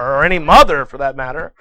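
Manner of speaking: plain reading voice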